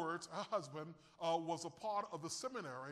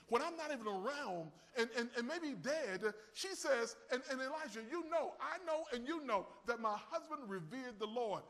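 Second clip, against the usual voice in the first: preaching; 4 semitones higher than usual. Acted. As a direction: preach the widow's words out with force. Preacher raising voice again.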